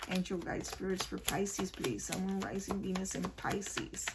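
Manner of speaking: soft, murmured plea to the guide spirits; quiet invocation. Real, not acted. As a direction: call on the spirits softly, like a small prayer. Invokes spirits before drawing cards.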